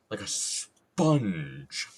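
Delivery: SPONGE drawn out slowly with a long hissed S; effortful emphasis. Real, not acted. Streamer labouring the punchline of his joke, heavily emphatic SPONGE. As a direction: lean hard into SPONGE, stretching it out. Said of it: "put a lot of effort into saying sponge".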